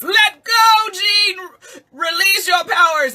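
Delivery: booming high commanding call; shouted movie-hero command; dramatic movie quote. Retold or imitated. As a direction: call out like a hero urging her on. Quoting X-Men movie line.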